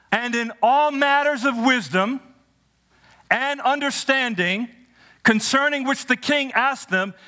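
high, slow, swelling scripture read; preaching scripture. Acted. Preacher reading Daniel to students, building and slow.

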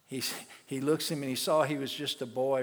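normal talking voice

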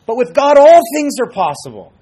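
very loud, high, rousing proclamation; preaching shout. Real, not acted. Preacher countering "impossible" for the congregation, shouting it out high. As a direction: shout it out to the whole church.

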